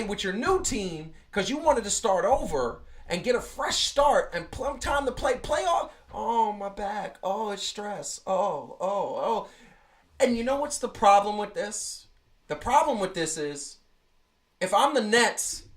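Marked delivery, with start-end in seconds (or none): 6.1-9.5 slow, softer hammed-up moans of pain; mock pained groaning. Mocking the player's excuses with hammed-up groans. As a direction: fake a sore back with exaggerated groans.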